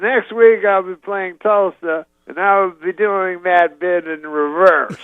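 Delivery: high, slow Cosby announcement; imitating Cosby on stage; Cosby punchline. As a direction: deliver the joke slowly up high. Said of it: "at the end he goes".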